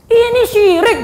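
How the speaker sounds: high, loud and blunt; forceful warning from the pulpit; firm declaration. Shown as a demonstration. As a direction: declare it without hedging. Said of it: "bilang aja syirik, tegas".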